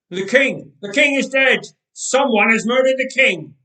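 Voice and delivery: loud, high panicked cry; shouting. Acted. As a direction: scream out the alarm. Attendants cry out the death.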